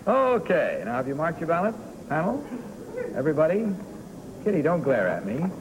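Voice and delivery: ordinary talk, nothing marked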